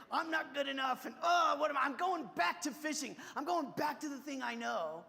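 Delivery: high, loud, despairing cry; anguished outburst. Retold or imitated. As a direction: blurt out the shame and give up. Peter covered in shame.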